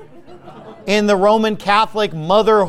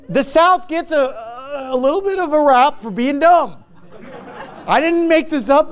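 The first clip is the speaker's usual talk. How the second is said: laughing; 6 semitones higher than usual. Real, not acted. Laughing while talking.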